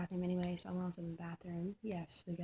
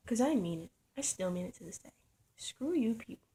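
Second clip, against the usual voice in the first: ordinary talk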